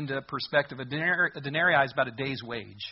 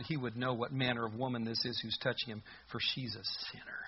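softer, muttered under the breath with scorn; quiet disdainful mutter; 4 semitones lower, 7 dB quieter than usual. Acted. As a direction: grumble it to yourself, not aloud. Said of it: "notice it's not real loud".

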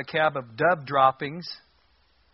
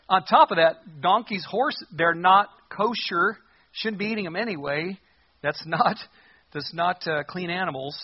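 ordinary talk, nothing marked

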